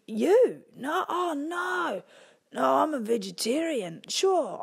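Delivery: wolf protesting innocence; flustered wolf voice. Acted.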